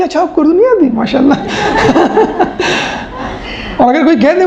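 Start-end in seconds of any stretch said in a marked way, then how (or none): 0.0-1.3 mock admiring. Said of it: "ہمیں الٹا حساب ہے". 1.4-3.7 a breathy, airy laugh at the irony; amused laugh. Real, not acted. Teacher laughing at the irony of praising poor Urdu, amused laugh.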